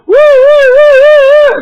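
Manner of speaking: very high, loud whooping woo; imitating a wild woo. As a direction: whoop like an overexcited prankster.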